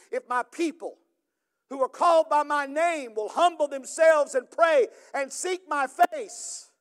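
fervent pastor proclaiming to the church; full-force preaching. Acted. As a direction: drive the scripture home from the pulpit.

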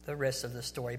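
low, soft radio sign-off; Paul Harvey. Acted. Preacher imitating a radio host's sign-off, low and soft. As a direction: wind down quietly like a storyteller closing. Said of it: "if I was Paul Harvey".

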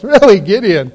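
teasing mock praise, dropping at the end; sarcastic. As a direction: needle him with fake admiration. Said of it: "has a bit of sarcasm here".

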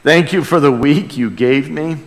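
normal talking voice

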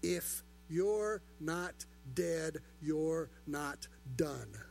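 very slow, every word weighed; slow emphatic. Shown as a demonstration. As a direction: let it land slowly, word by word. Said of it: "Let that sink in".

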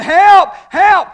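high, loud cry for help; hollering for help. Retold or imitated. Preacher in kangaroo suit chased by lion, loud high yells. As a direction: yell it like the lion's right behind you. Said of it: "he starts hollering".

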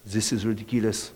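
put-on light French accent, low and soft. French friend scoffing at a huge cereal aisle, in a light French accent. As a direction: mimic a French friend's disbelief. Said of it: "in his French accent".

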